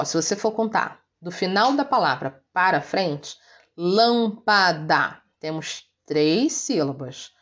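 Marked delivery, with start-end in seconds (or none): 3.8-5.2 lâmpada very slow, syllable by syllable; drawn-out lâmpada with weight on the first syllable; stress on LÂM. Shown as a demonstration. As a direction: count out lâm-pa-da, lâm strongest. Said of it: "a sílaba acentuada é a antepenúltima".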